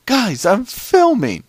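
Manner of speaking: high, loud, drawn-out protest; frustrated yell. Retold or imitated. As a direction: yell back exasperated at the interruption. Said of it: "I was getting frustrated".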